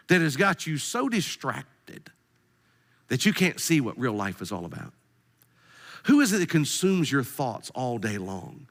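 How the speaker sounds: ordinary talk, nothing marked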